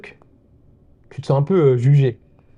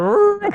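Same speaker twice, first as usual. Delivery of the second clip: ostrich noise. Retold or imitated. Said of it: "et t'entends".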